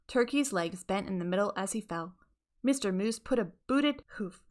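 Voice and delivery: ordinary delivery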